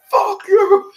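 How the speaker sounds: high, loud mock curse; hammed-up outraged yell; cursing heckle. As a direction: holler it at the movie screen. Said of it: "I'm gonna curse the shit out".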